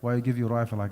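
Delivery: normal talking voice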